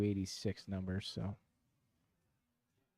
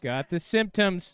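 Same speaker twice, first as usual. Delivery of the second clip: excited; 7 semitones higher, 6 dB louder than usual. Real, not acted. Ball tracking toward the pin.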